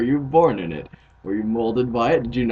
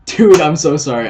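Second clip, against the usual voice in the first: ordinary talk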